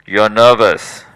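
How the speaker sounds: the anxious mind calling it out; loud accusing inner voice. Retold or imitated.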